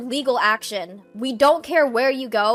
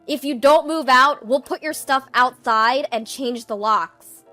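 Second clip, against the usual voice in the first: very loud, stern final warning; forceful threat; 3 semitones higher, 12 dB louder than usual. Acted. Eden threatens to change the locks on his sister, loud and forceful. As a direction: threaten with full voice.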